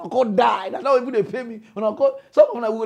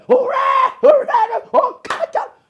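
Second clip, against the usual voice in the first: very high, loud mock hooray; mock cheering; 9 dB louder, slower than usual. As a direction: ham up a jubilant believer. Mocking a celebrating prayer guy.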